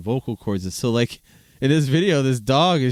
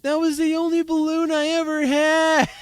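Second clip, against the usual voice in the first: put-on much higher, childish wailing quote; little girl voice; 3 dB louder than usual. Quoting the girl at Drug Mart over her balloon, high childish wail. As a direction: wail it like a little girl who lost everything. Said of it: "she says".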